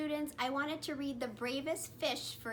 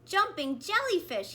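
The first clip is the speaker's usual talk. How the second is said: higher, louder yelp; startled cry; 7 semitones higher, 5 dB louder than usual. A narrator's reading. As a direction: cry out in shock. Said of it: "he cried".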